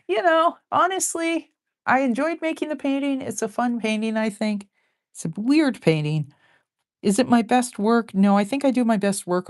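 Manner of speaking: high-pitched musing, acted out; voiced own thoughts. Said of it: "And I was thinking".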